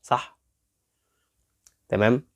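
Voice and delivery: ordinary talk, nothing marked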